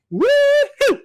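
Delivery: high whooping cheer; excited cheer. Real, not acted. Cheering about game news.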